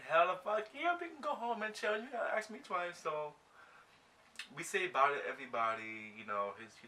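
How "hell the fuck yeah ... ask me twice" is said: high-pitched, keen yes; acted-out enthusiasm; eager agreement. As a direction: jump at the offer, pitched up.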